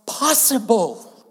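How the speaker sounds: usual speaking voice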